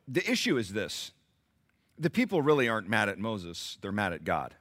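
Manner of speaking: ordinary talk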